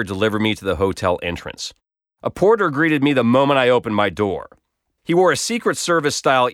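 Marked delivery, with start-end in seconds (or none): none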